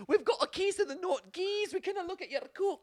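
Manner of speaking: very high-pitched cartoon Scottish accent; mock Scottish accent. Scots explaining themselves to Bostonians, squeaky mock Scottish accent. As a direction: pipe it up in silly falsetto Scottish. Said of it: "they were like".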